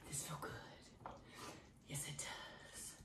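breathy whisper. Real, not acted. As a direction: whisper it to yourself. Quiet agreement during exercise.

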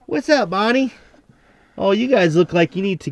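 high cooing animal talk; pet talk. Real, not acted. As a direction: sweet-talk the goat. Greeting goat in pet voice.

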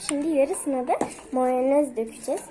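ordinary talk

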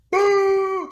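a huge high booing yell; booing scream. Retold or imitated. Retelling booing Jason Garrett at training camp, full-throated high yell. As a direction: boo him as loud as you can.

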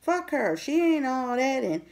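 lightly put-on catty gossip; catty jealous woman. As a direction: sneer it like a hater gossiping.

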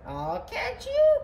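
very high call; squealed falsetto cry. A narrator's reading. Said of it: "cried Nellie".

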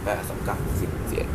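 whispered count. Real, not acted. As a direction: whisper the numbers. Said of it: "counting under breath". (นับเลขกระซิบ)

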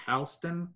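"Houston" said HOW-stun; Houston with an "ow" vowel in the first syllable. Shown as a demonstration. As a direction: say Houston starting like "house". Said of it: "accepted pronunciation is".

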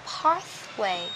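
British accent, slow PATHWAY with a long "ah". Shown as a demonstration. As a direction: model PATHWAY the British way, "pahth-way". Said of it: "[name] sẽ đọc là pathway". (giọng Anh, PATHWAY âm a dài)

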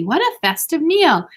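high, slow, delighted praise; exclaiming. A narrator's reading. Mother admires her daughters' dinner, exclaiming. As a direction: draw it out with delight. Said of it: "exclaimed Missus Quimby".

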